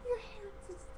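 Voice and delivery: hammed-up tearful whimper, halting and very high; mock crying. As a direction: imitate a mom too tearful to speak. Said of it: "my mom was just crying".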